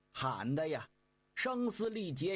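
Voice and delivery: ordinary delivery, nothing marked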